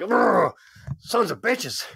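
annoyed groan. Retold or imitated. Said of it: "I'm like".

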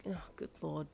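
soft, weary groan. Acted. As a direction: mutter it in despair. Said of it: "you groaned".

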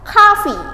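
coffee said as kafi, high and clear; model pronunciation. Shown as a demonstration. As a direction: say coffee as KAH-fee with a bright falling tune. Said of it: "kita baca". (coffee diucapkan kafi, nada tinggi lalu turun)

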